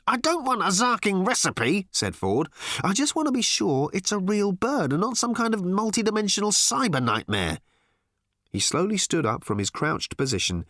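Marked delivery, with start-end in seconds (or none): 0.1-1.9 high, irritated outburst; exasperated interruption. A narrator's reading. Ford cuts off Arthur's recipe, exasperated. 2.8-7.6 high, agitated insistence; exasperated. A narrator's reading. Ford fears the bird is unreal, exasperated.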